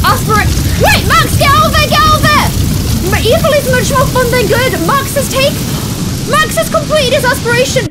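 high loud frantic yell; frantic shouting. Real, not acted. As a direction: shout in panic. Max in fire.

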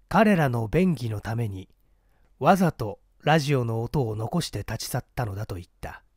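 ordinary delivery, nothing marked

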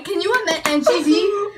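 loud, high, eager fan outburst; excited fantasizing. Real, not acted. Fan imagines dream rap collaboration with friend, loudly excited. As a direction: pitch it up and loud, thrilled by the idea.

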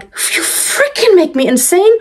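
high-pitched exasperated outburst; venting. Retold or imitated. Mother venting alone outside the room, exasperated. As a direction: let off steam in a high, strained vent. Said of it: "I vent".

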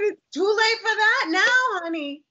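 high, slow, loud teary imitation; mock doctor soothing her; tender doctor. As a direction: mimic the doctor kindly. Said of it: "He said".

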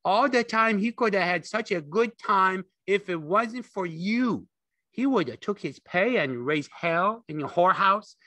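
high, loud tirade; scolding aunt. A narrator's reading. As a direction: lay into him like an angry aunt.

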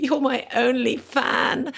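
milk the gratitude, drawn out and lifted; a hammed-up, slowed plea to a stranger; overly grateful. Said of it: "very gratefully".

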